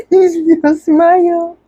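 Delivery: high laughing coo. Real, not acted. Cooing at baby.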